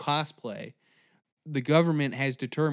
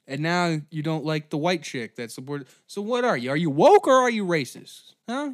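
usual speaking voice

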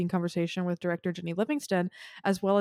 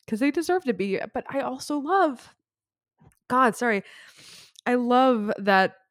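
fighting back tears mid-sentence; emotional, close to crying; choked up; 5 semitones higher, 4 dB louder than usual. Real, not acted. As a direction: voice cracking up high through tears. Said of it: "I feel like I'm just bawling".

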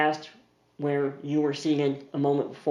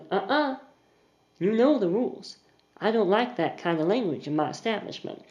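higher, prim scolding voice; stern hostess; 5 semitones higher than usual. Acted. Charlotte scolding Mister Harbin, prim and higher. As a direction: wag a finger at him, lightly voiced.

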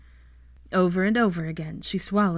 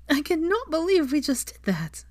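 laughing; 10 semitones higher than usual. A narrator's reading. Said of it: "she laughed".

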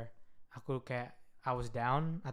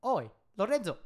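put-on Scottish accent; 5 semitones higher than usual. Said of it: "Dia kayak".

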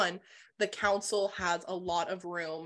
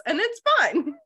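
sarcastic; 10 semitones higher, 7 dB louder than usual. Real, not acted. Sarcastic high aside.

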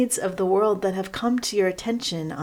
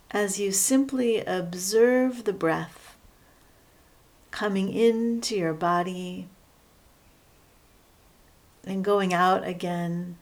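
guided meditation. Real, not acted. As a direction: guide them unhurriedly into settling, leaving space between phrases.

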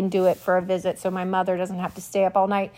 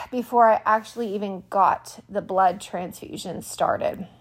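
usual speaking voice

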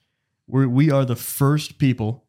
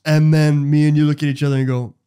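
ordinary talk, nothing marked

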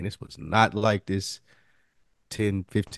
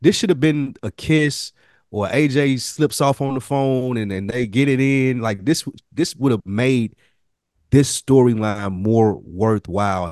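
raised, louder, worked-up rant; heated complaint; 4 semitones higher, 7 dB louder than usual. Real, not acted. Podcaster venting to co-hosts, loud and worked up. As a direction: push the point hard, getting loud. Passionate complaint about the storyline.

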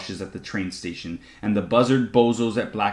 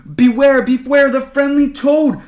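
very high, loud warning; alarmed shout; 11 dB louder than usual. A narrator's reading. Davery recalls the warning about the toad, alarmed shout. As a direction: cry out the warning urgently.

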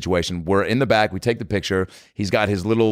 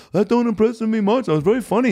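high-pitched star caricature; 12 semitones higher than usual. Retold or imitated. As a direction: quote it back in a high put-on voice. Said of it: "He's like".

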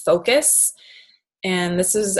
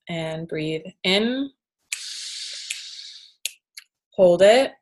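guided breathing. Shown as a demonstration. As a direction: lead the breath calmly, with long pauses. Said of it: "let's actually just do that right now".

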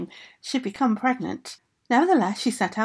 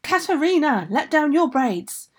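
loud, higher call up to the window; ogress shouting; 6 semitones higher, 4 dB louder than usual. A narrator's reading. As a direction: bellow up at the tower. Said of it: "began shouting".

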